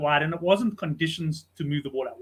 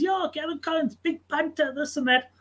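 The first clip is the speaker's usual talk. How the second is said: a hint of the character, higher; lightly voiced storyteller, pitched up; imitating the character; 10 semitones higher than usual. The character insisting on the big punter, lightly imitated high.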